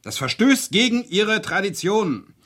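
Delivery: ordinary talk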